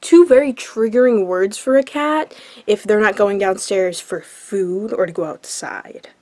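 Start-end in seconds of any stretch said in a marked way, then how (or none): 4.0-6.1 hushed aside; whisper. Real, not acted. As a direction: drop to a whisper.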